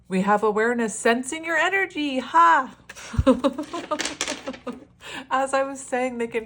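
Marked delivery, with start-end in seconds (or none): 0.1-2.2 high, loud burst of delight; excited. Real, not acted. Reader thrilled at the card to viewers, excited. 2.2-2.8 sharp high loud "Ha"; one loud triumphant laugh; delighted bark of a laugh. Real, not acted. Reader delighting at the card, sharp laugh. 2.8-5.2 high bubbly laugh; giggling laugh. Real, not acted. Reader laughing at the card's timing, giggly. Laughing after card reveal.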